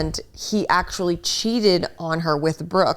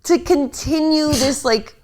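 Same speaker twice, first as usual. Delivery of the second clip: rising, louder disbelief at a liar; incredulous; 8 semitones higher, 4 dB louder, slower than usual. Real, not acted. Friend ranting to friend about liar, incredulous and louder. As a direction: push it up in outraged disbelief. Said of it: "incredulous rant".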